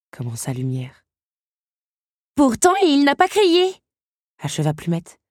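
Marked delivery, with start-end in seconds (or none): 2.3-3.7 very high, loud, drawn-out amazement; astonished. A narrator's reading. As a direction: marvel at it, slow and pitched way up. Stunned servants finish each other's sentence. (étonnement d'une voix très aiguë)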